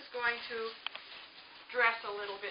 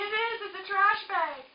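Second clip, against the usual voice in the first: higher, louder delighted teacher answer; excited praise; 11 semitones higher, 7 dB louder than usual. Real, not acted. Teacher happily confirming a child's answer about the trash bag.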